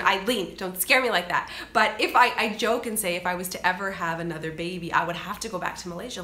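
ordinary delivery

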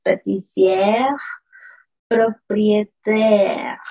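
slow, each syllable of "propriétaire" pronounced fully; slow careful French. Shown as a demonstration. Teacher stressing accents to the class, slow and careful. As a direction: spell out "pâtissière" and "propriétaire" slowly. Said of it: "Atención con las tildes".

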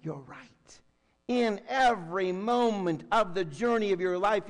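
ordinary talk

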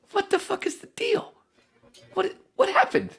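high, stunned squeak of disbelief; way-up-high stunned outrage; shocked disbelief. Retold or imitated. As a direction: react like you can't believe your ears. Retelling shocked reaction.

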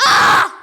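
loud sudden aah; shocked yell. Real, not acted. Gamer startled by the game, sudden loud yelp.

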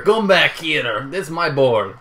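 hammed-up, slow Spanish accent that sounds French; bad Spanish accent. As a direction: do a badly faked Spanish accent, drawn out. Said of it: "That's the worst French accent".

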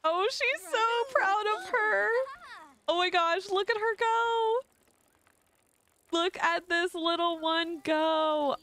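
high, delighted cheering; excited. Real, not acted. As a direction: gush over the little one. Proud watching child ride.

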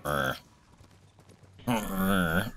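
low, soft grumbling "arr"; imitated dog grunt. Owner imitating Jada grunting in sleep, low soft grunt. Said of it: "she's doing all sorts of grunts".

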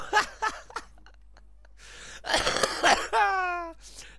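high wild cackle; loud laugh. Real, not acted. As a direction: laugh it out hard. Laughing fit.

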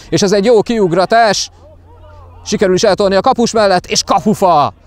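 high, fast breakaway call; excited hungarian sports commentary. Real, not acted. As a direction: call the chance rising to the post. Breakaway hits the post.

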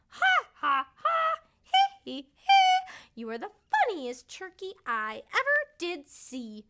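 very high, loud squawking hee hee; squawking parrot laugh. A narrator's reading. Parrot squawking laughter at the yellow turkey. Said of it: "purple parrot squawk".

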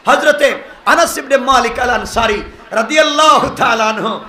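ordinary delivery

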